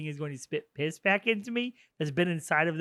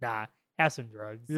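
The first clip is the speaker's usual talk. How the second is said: low, slow, softer dismissal; mock nonchalance; 6 semitones lower, 5 dB quieter, slower than usual. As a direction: drop the voice and slow right down. Retold interrogator coolly drugs captive.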